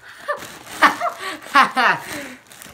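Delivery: high squeaky giggle; helium giggle. Real, not acted. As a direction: laugh on a lungful of helium. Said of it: "my voice is gonna be funny".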